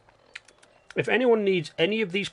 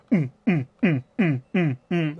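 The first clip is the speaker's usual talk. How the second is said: beatboxed house beat. Retold or imitated. Explaining house music, hums the beat. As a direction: mimic a thumping club beat with the mouth.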